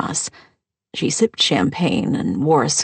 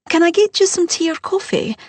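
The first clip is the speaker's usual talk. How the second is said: much higher, bright offer; lightly voiced bride; 13 semitones higher than usual. Bride offering tea to her mother-in-law, much higher. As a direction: ask it sweetly up high.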